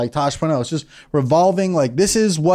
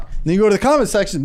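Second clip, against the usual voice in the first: normal talking voice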